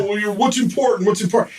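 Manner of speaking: high, mocking voice of the critics; imitating people questioning his priorities; mocking other people. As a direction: parody the people who question him. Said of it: "people say".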